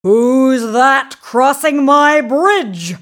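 booming, drawn-out monster challenge; troll's roar. A narrator's reading. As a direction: bellow it slowly as a big troll. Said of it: "roared the big hairy troll".

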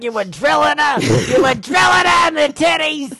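high mock mother shouting crude words; continuing the joke, mock mom voice. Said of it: "Can't you say something nice like".

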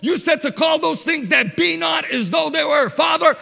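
put-on a child's prayer built to a loud shout; loud storming prayer. A seven-year-old boy loudly prays to God for training wheels. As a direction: pray it up a storm, loud and fervent. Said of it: "he was praying up a storm".

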